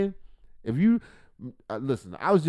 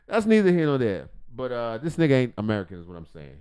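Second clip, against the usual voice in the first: ordinary delivery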